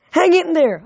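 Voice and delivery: high, loud urging; yelled encouragement. A narrator's reading. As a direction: call out to keep a hurt friend going. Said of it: "I yelled at Guido".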